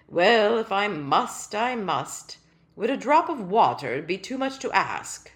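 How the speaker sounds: loud, stately and resigned; a small royal making do; put-upon tiny king. Acted. Tiny king accepting breadcrumbs with a sigh, loud and resigned. King's voice held.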